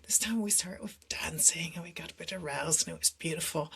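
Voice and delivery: breathy confiding whisper, voice dropped; intimate whisper. Real, not acted. Telling the host about her partner, whispered confidingly. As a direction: hush it like sharing a secret.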